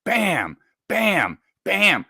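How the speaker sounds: punchy high bams, evenly spaced; imitated powerful knocks. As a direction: imitate heavy blows landing one by one. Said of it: "it was powerful. So powerful, five in a row".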